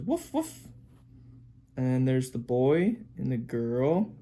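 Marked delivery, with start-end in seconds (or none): 0.0-0.7 high, short woofs; dog bark. Acted. Parent pointing out the dog again, dog bark. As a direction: yip twice like a little dog. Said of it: "Dog says".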